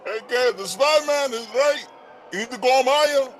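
phone voice. Acted. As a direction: voice coming through a phone. Second caller over the phone.

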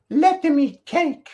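high, drawn-out mock royal voice; Marie Antoinette. Host mocks the French queen's famous line, high royal put-on. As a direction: sing it out high, loud and slow. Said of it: "Marie Antoinette".

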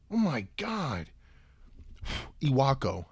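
usual speaking voice

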